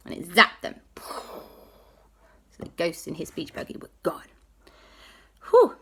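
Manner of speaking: ordinary delivery, nothing marked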